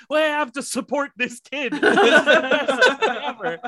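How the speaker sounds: high, loud, laughing crowd voice; excited audience. Retold or imitated. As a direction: imitate a whole crowd cheering on a kid. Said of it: "the whole audience was like".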